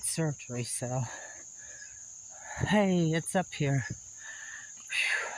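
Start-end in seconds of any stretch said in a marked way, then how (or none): 3.9-5.3 whispered winded puff; breathless exhale. Real, not acted. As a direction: blow out air, spent. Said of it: "I'll have to take my breath up here".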